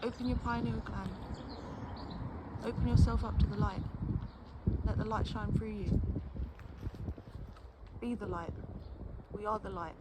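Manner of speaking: lightly intoned mock spiritual blessing; mock guru sermon. Mock sermon to everybody under the sun. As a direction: bless them like a hippie guru, tongue in cheek.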